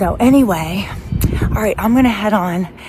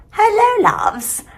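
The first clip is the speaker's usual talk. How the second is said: sing-song greeting; much slower than usual. Acted. Vlogger greeting her viewers in a drawn-out sing-song. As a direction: stretch it out like a cheery hello.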